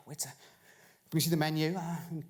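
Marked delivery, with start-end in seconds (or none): none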